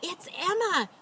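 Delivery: farmer's wife gasping in surprise; gasped. Acted. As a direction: catch your breath as you spot her. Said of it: "gasped the farmer's wife".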